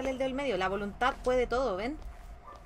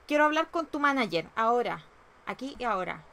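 mock-indignant demand; 4 dB louder than usual. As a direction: demand it loudly, playing outraged. Demanding a manager from the game.

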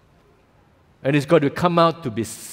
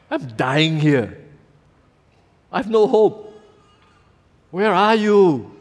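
ache it out, slowing to the end; anguished prayer; 2 semitones higher than usual. Acted. Voicing an anguished honest prayer.

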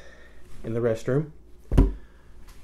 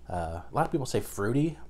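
ordinary delivery

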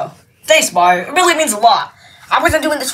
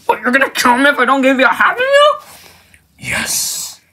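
ordinary delivery

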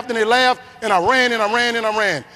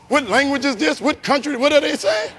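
higher-pitched mock bewilderment; baffled; 4 semitones higher than usual. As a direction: imitate a puzzled viewer, pitched up. Imitating baffled television viewers.

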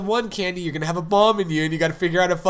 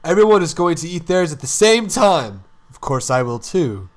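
ordinary talk, nothing marked

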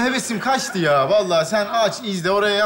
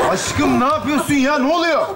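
alarmed protest; 6 semitones higher, 4 dB louder than usual. Acted. Protesting girlfriend's reaction to cough.

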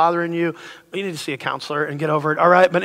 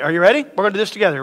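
usual speaking voice

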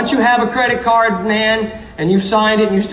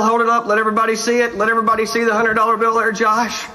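ordinary talk, nothing marked

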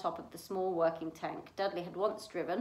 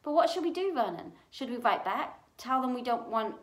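higher, worried questions; anxious fretting; 4 semitones higher, 4 dB louder than usual. A narrator's reading. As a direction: fret aloud, asking her husband what to do. Aunt frets about the letter.

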